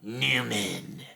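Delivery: mock disdainful Newman; a hint of Jerry Seinfeld's annoyed greeting. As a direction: sneer the name like Jerry Seinfeld. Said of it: "he would be like".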